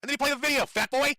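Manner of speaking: yelling. Real, not acted. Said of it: "yelling at you".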